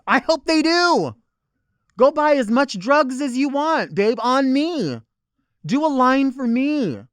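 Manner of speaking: high, loud, slowed mock-generous taunt; defiant loud rant. Real, not acted. Comic telling critics she'll fund the drugs, high and loud. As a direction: dare them, drawn out and loud.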